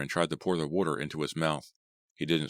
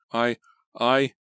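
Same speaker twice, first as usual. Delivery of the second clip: stammer; 7 semitones higher, 6 dB louder than usual. A narrator's reading. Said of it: "P J stammered".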